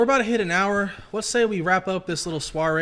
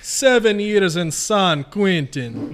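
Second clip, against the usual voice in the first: slow, hammed-up fake Jamaican voice; mock Jamaican accent; 2 semitones higher, much slower than usual. Prisoner bragging about jail time in a slow fake Jamaican accent. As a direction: drag it out in a bad Jamaican accent. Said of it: "We're doing Jamaican next time".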